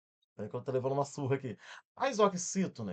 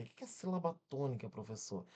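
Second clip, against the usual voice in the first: softer aside, lightly voiced student asking the teacher; student's question; 2 semitones lower, 8 dB quieter than usual. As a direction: ask quietly and quickly, like a puzzled pupil. (pergunta de aluno, mais baixinho)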